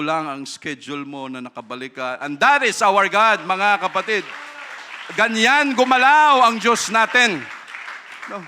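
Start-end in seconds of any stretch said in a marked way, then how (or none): 2.2-8.4 much higher and louder, proclaiming; preaching at full force. Acted. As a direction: lift the whole room with this declaration. Climactic praise to congregation.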